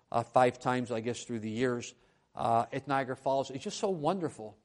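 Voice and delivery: ordinary delivery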